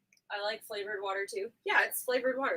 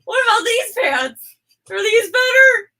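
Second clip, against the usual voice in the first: high, loud, slow mock swoon; hammed-up overjoyed reply to an insult; gushing delighted shout; 12 semitones higher, 14 dB louder, slower than usual. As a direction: squeal it out like a smitten fan. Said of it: "I'm like".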